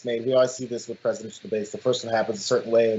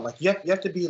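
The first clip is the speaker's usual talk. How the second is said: usual speaking voice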